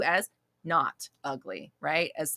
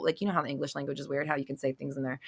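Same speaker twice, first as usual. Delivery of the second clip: ordinary talk, nothing marked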